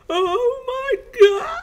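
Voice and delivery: high, loud, drawn-out shriek; wailing death scream; incoherent shriek. A narrator's reading. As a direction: shriek it in acted agony. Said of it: "in an incoherent sh-, shriek".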